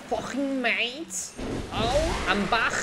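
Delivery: slow, drawn-out mock Liverpool Scouse accent. Gamer jokes about slipping into a Liverpool accent. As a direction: stretch the words out in a hammed-up Liverpool accent. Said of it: "l'accent scots de Liverpool".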